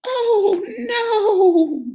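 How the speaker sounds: very high, loud crying bleat; bleating sheep. A narrator's reading. Sheep despairs at the dark sky. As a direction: wail like a scared lamb. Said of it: "bleated the sheep".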